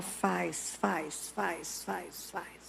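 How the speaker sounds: low, hushed, insistent demonic urging; demon's whisper. Retold or imitated. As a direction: murmur it low like a tempting demon, fading to almost nothing. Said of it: "o demônio que tá em cima dele fala". (sussurro demoníaco, grave e insistente)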